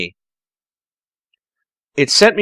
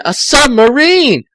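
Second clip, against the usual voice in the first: excited reveal; 12 semitones higher, 5 dB louder than usual. Acted.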